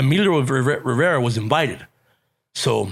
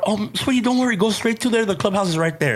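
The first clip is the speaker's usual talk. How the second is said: kind lady voice; 8 semitones higher than usual. Retold or imitated. Kind receptionist voiced in story.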